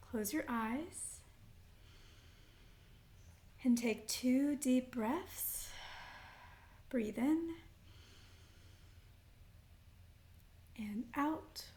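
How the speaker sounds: unhurried meditation guide with long pauses; guided meditation. Acted. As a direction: lead them gently into breath and intention, phrase by phrase. Leads grounding breaths and intention setting.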